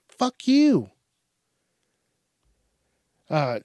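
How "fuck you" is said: high, loud fuck you; sudden high-pitched curse; angry snap. Real, not acted. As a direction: spit it out at him. Angry retort at beggar.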